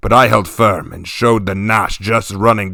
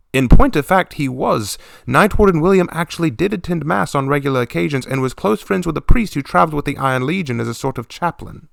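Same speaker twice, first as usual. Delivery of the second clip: lifted, higher storytelling aside; higher narrator aside; 6 semitones higher than usual. A narrator's reading. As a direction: drop in a wry higher aside. Narrator aside about warden.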